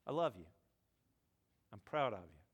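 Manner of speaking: low, gentle father saying it plainly; father's loving voice. Retold or imitated. Dad finally tells his son he loves him, low and gentle. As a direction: let it land like a father's blessing. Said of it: "there's something about the voice of your father".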